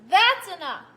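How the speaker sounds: loud shouted demo of the big words; teacher showing big letters mean yelling. Shown as a demonstration. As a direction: shout it like the farmer. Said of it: "That means he's yelling at her".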